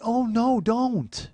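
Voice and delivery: high, loud startled no don't; high loud alarmed plea as mom yanks the finger clip; alarmed cry. Retold or imitated. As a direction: gasp it out involuntarily. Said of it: "involuntarily, Jill went".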